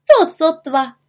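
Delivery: high, loud dismissive retort; confident little witch cry; calling out. A narrator's reading. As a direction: exclaim it, brushing off the warning. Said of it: "zvolala malá čarodějnice".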